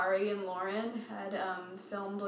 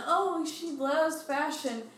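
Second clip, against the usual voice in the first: mocking; 7 semitones higher, slower than usual. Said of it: "And then".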